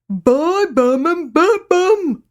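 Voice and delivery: very slow, high, loud "Buy bum and bed bum"; garbled toothless cry. Acted. Grandad pleading again with the girls, garbled toothless cry. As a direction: wail it slowly without teeth. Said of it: "the garbled cry".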